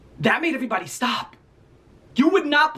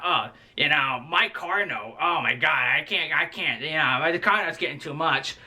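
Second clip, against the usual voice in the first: a lightly lowered, mock-weary old-lady voice complaining about bills; mock lunch-lady gossip, pitched lower; mocking old lunch aides; 5 semitones lower than usual. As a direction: imitate a tired older aide griping, voice dropped low. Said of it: "they would just talk about old people shit".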